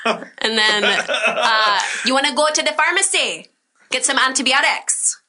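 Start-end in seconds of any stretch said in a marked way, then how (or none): 2.1-5.2 higher Roatan island lilt; Roatan accent. Retold or imitated. Stepmother's family asking about the pharmacy, in Roatan accent. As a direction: imitate the Roatan stepmother's family offering antibiotics. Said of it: "there are a couple phrases".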